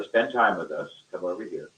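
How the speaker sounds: ordinary talk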